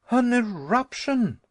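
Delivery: high, loud stunned exclamation; horrified disbelief; shocked gasp. Acted. Nephew echoing uncle's news in shock, high gasping. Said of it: "I gasped".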